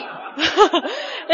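loud rising laugh at own joke; big warm laugh; hearty laugh. Real, not acted. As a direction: burst out laughing.

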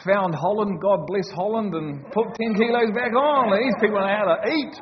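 loud, high-pitched comic rejoicing; jubilant praise. Real, not acted. Preacher praising Holland's food after Italy, jubilant and loud. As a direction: build to an exuberant high shout.

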